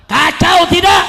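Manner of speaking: high, very loud question to the crowd; rallying shout. Real, not acted. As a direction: shout it to demand an answer. Repeats question to rouse crowd.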